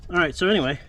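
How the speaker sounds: usual speaking voice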